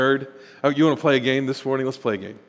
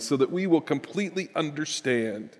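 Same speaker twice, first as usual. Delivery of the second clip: ordinary talk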